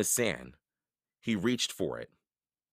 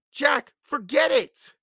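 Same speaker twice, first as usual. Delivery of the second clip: high, slow, loud protest; urgent yell; 7 dB louder than usual. A narrator's reading. Annie tries to stop Jack returning, urgent yell. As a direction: yell to stop him going.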